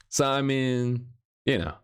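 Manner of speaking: normal talking voice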